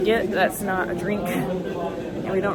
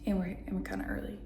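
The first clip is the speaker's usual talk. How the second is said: hushed voice, softer throughout; whispering while hiding; 7 dB quieter than usual. Real, not acted. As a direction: keep it down in a quiet lounge. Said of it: "I'm hiding in the bathroom".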